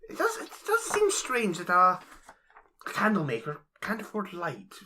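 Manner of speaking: put-on nervous stammering elderly scholar; hesitant, meek old reader; timid old bookish voice. Player voicing timid character.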